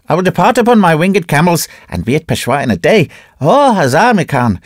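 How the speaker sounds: high, fast, loud command; yelling priest. A narrator's reading. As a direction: yell at the servant in mad haste. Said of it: "he yelled at his servant".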